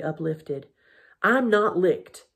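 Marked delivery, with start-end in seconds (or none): 1.2-2.3 slow, firm and louder; positive, determined. A narrator's reading. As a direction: refuse defeat with slow, raised emphasis. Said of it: "she said in that positive voice".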